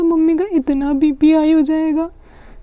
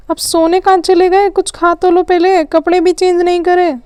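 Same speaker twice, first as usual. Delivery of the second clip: higher, louder call across the house; mother calls after the kids who went to bed; 5 semitones higher, 4 dB louder than usual. Acted. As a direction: call after them, exasperated. Mother calling after sleeping kids.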